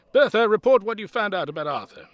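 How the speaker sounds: retired soldier commanding a fellow resident; barked order. Acted. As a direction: bark it like a drill sergeant. Ex-army man gives an order.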